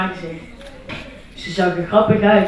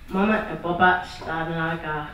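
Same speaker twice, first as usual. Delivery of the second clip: plain reading voice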